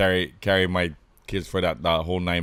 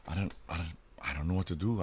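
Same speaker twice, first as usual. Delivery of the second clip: softer, trailing helpless reply; lost and helpless; 5 semitones lower, 13 dB quieter than usual. Retold or imitated. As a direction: mumble it like you're clueless. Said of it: "And I'm like".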